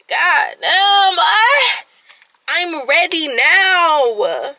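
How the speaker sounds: loud, high burst of exasperation; exasperated cry. Real, not acted. Woman venting that she is ready now, exasperated. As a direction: cry out impatiently, then drag the words out.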